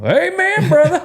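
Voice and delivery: high-pitched hollered church response; church amen yell. Shown as a demonstration. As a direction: holler it up to the pastor from the pew. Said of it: "distracting enough whenever I yell".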